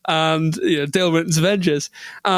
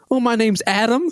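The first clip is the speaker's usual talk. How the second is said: higher-pitched imitated American accent; 6 semitones higher than usual. American newcomer introduces himself to teammates, mock American accent. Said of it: "this American guy comes up to us".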